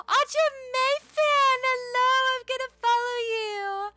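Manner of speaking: excited high-pitched gushing; gushing greeting. Real, not acted. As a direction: greet a fan with squealing delight.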